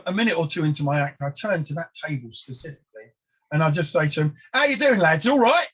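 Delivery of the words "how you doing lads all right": put-on loud, high London-accented hello to the lads; cheery London greeting. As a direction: greet the table in a bright London accent, with a laugh. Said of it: "come out with this sort of London accent".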